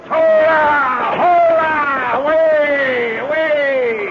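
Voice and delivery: high, loud charging yells; battle cry. Acted. Commander yelling as he leads the cavalry charge. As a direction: roar them into the charge.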